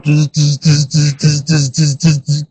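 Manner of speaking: quiet imitated robot whirring; robot buzzing. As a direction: buzz softly like a little machine. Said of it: "this thing starts going".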